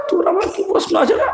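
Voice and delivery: put-on high, sneering question to a kid; mock-scornful high "তোর আবার কি প্রশ্ন আছে রে?"; dismissive retort. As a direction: brush off the cheeky boy. Preacher mocks the cheeky boy.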